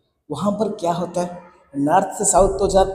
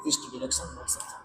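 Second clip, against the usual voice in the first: quick whispered aside, much softer; whispered repeat; 8 semitones lower, 17 dB quieter, faster than usual. Real, not acted. Teacher repeating the answer to himself in a fast whisper. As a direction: murmur east direction fast under your breath.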